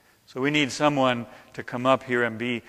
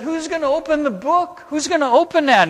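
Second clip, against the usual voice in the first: high, raised voice of an angel; angel calling out; 13 semitones higher, 5 dB louder, faster than usual. Retold or imitated. Angel calls out to find someone to open the book, high and urgent. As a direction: call out the question high and loud.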